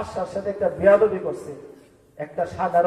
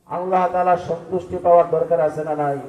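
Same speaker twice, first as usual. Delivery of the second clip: ordinary talk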